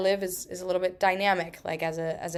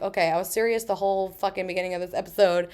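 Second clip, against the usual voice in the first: ordinary delivery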